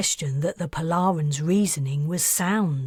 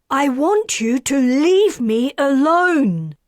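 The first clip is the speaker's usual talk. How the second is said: much higher and louder, yelling; tearful shout; 8 semitones higher, 7 dB louder than usual. Acted. Karis screams at Perseus to leave her alone. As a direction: scream him away through tears.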